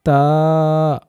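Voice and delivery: তা held for one alif length; drawn-out letter. Shown as a demonstration. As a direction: stretch তা clearly. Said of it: "আরও চমৎকারভাবে সুন্দরভাবে বলার চেষ্টা করুন". (তা এক আলিফ টেনে)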